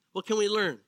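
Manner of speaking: ordinary talk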